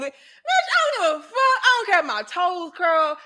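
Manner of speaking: high shout breaking into laughter; laughing high yell. Real, not acted. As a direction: blurt it out through real laughter. Laughing while retelling the scene.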